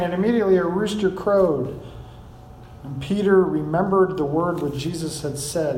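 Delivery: usual speaking voice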